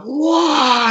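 very high "whoa" wail; imitated wind-up cry. Wrestler winding up for the next kick, imitated cry.

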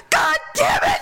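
high-pitched, hammed-up angry outburst; mock mad yell. Woman retells Daryl's reaction to her scream, mock mad yell. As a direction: imitate an outraged man cursing. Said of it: "Daryl got mad".

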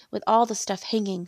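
usual speaking voice

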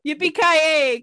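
loud playful cowboy cheer; mock cowboy whoop. Mock Russian cowboy catchphrase.

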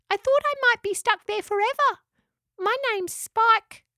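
very high, squeaky small-animal voice; little echidna. A narrator's reading. Spike introduces herself to her rescuers, little echidna voice. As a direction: squeak it like a tiny creature.

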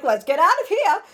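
high, loud retold urging; rally friends to flee, hammed up; mock-urgent exit call; imitating urgent escape. Acts out Lily urging escape.

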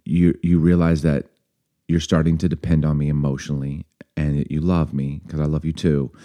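soft, low and tender reassurance; lovingly. Retold or imitated. Girlfriend reassuring frightened boyfriend on the phone, lovingly. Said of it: "you said it really lovingly as a girlfriend".

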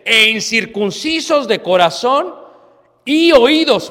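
rising to a high, loud cry; fiery preacher climax; preaching rebuke. Acted. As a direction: build the rebuke to a shout. Preacher quoting Stephen.

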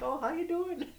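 very high, sing-song Canadian cop; mock polite Canadian officer. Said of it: "and he's like".

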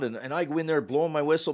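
normal talking voice

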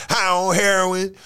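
put-on high, slow, loud old lady scold; grandmother voice. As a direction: tut like a shocked grandma. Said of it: "that was my imitation of a, of a grandmother".

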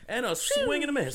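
high, loud hammed-up announcer; sports announcer. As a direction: squeal the call like a hype man.

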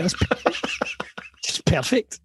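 breathless whisper through giggles. Retold or imitated. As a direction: laugh it out in a hushed whisper. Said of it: "And I was just like".

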